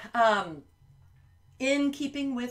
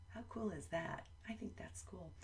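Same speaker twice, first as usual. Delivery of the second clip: breathy secret whisper; 3 semitones lower, 15 dB quieter than usual. Acted. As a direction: share a little secret with the kids. Secret aside to children.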